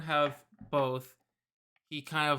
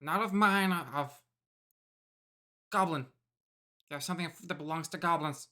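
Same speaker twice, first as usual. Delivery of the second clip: goblin voice. Acted. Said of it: "It's a very good goblin voice".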